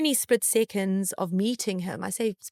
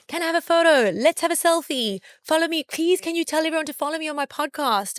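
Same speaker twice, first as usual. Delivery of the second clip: high, louder eager fan begging for a selfie; mock the needy fans, pitched up; pushy fan; 8 semitones higher, 3 dB louder than usual. Fans crowding him, begging for photos. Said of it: "every person that normally comes to meet him is like".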